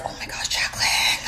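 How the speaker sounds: hushed self-scolding whisper; soft breathy reprimand to herself. Retold or imitated. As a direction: whisper it as if talking yourself down. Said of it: "I'm just like".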